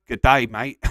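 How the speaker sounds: loud, hammed-up Australian greeting; jokey Australian accent, loudly; bad Australian accent. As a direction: belt out a cheesy mock Australian accent. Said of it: "Sorry for the bad Australian accent".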